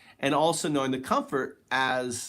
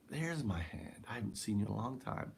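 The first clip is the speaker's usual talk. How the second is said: quiet wonder; 2 semitones lower, 9 dB quieter than usual. Retold or imitated. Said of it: "I'm like".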